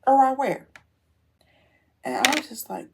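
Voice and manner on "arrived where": loud, high burst of disbelief; incredulous. Retold or imitated. Car owner answers a false arrival notice, incredulous. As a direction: fire it back as a baffled challenge.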